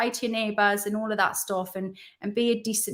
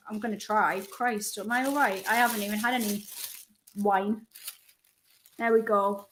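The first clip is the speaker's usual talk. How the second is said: normal talking voice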